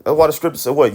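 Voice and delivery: normal talking voice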